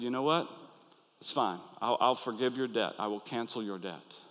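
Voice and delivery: a touch of a merciful master; gentle forgiveness. Retold or imitated. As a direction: let him off kindly and quietly. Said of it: "the master had pity on him".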